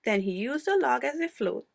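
ordinary delivery, nothing marked

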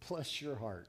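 soft, low, drawn Southern "Bless your heart". Shown as a demonstration. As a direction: say it like a sweet Southern lady. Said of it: "my favorite was this".

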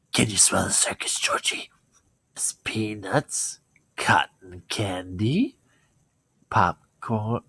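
creepy clown, whispering and slow; hushed, drawn-out clown temptation; Pennywise voice. Pretend. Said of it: "do the new one".

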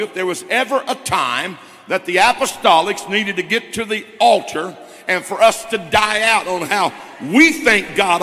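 building from firm to loud, driving pulpit preaching; preaching at full force. Real, not acted. Pastor pressing the church toward the altar, loud preaching. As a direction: preach it to the congregation, rising to a loud climax. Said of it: "I feel like preaching here a second".

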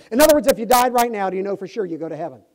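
loud start, then rushed x1.5; rapid-fire pitch. Retold or imitated. Preacher firing the gospel question at a stranger, loud and rushed. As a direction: rattle it off in one breath. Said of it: "I said it just like that".

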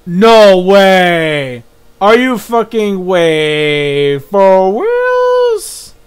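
real shocked outburst, drawn out; loud disbelief. Real, not acted. Player shocked by a game reveal, loud disbelief. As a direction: cry out in stunned disbelief.

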